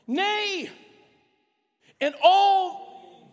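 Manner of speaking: NAY and ALL drawn out high, with a pause between; slow, emphatic reading. Real, not acted. Pastor reading the verse to the congregation, slow and emphatic. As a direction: proclaim it word by word, high and loud. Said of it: "trying to read it slow".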